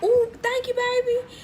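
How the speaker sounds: a sweet, high, put-on thank-you to a kid; delighted high-pitched "ooh, thank you, baby"; high grateful coo. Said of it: "I was like".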